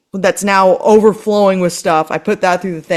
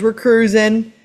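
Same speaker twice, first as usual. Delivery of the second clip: normal talking voice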